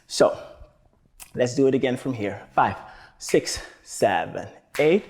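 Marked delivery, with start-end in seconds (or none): 2.5-5.0 slow, low "six, seven" then a lift on "eight"; a teacher counting in a dance routine. Shown as a demonstration.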